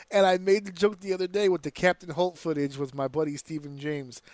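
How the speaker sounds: usual speaking voice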